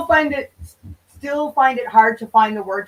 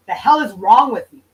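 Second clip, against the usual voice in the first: ordinary talk, nothing marked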